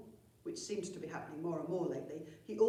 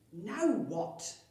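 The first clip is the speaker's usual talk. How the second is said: exasperated; 3 semitones higher, 6 dB louder than usual. Acted. Chief exasperated by noise.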